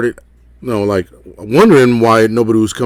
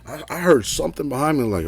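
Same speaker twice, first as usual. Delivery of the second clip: usual speaking voice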